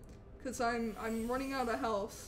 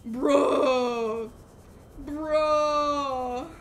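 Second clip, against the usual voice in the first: loud, drawn-out whine; mock wail; 7 dB louder than usual. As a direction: groan it out long like fake crying. Whining after dying in game.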